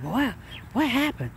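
soft, breathy-sounding surprise, half to yourself; taken aback by a friend's outburst; stunned wow. Retold or imitated. Soft stunned surprise at a sudden change.

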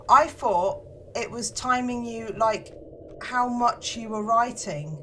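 ordinary delivery

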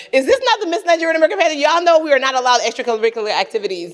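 high, rapid-fire objection; indignant protest. Retold or imitated. Contestant protesting to pageant organisers about the talent round, indignant. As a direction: rush it out in mock indignation.

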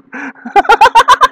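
a loud laugh rising up; real loud laughter; loud high laugh. Real, not acted. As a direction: burst out laughing loudly. Laughing loudly.

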